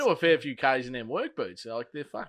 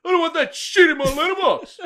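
high, loud mock shouting bloke; angry neighbour yell; 9 dB louder than usual. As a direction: bark it like the furious neighbour, half laughing. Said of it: "there's this bloke standing there going".

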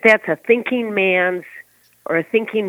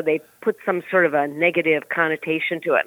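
ordinary delivery

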